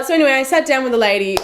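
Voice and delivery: ordinary delivery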